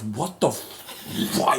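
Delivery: slow bewildered. Retold or imitated. Thor stops, slow reaction.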